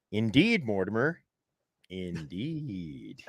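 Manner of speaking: measured, dropping low and soft at the end; film character quote. Acted. Host quoting Trading Places, lightly acted film character. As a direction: lightly play a film tycoon, fading down. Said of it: "Reminds me of Trading Places".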